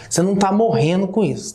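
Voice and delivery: ordinary delivery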